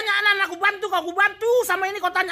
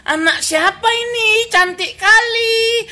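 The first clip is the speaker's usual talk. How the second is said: slow, doting village aunt; fond villager; 4 semitones higher, 6 dB louder, much slower than usual. Retold or imitated. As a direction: coo over a baby slowly. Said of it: "nama lain bayi di kampungku". (ibu kampung memuji bayi)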